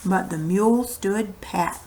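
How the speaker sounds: ordinary delivery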